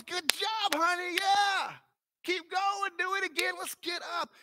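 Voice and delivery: put-on high, bright encouragement to a toddler; cheering parent. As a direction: coax the baby to keep walking. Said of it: "Our reaction was".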